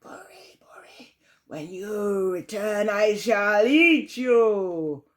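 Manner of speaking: whispered name, then a slow roar; roaring lion. Acted. Lion roaring slowly at old Buri. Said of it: "roared the lion".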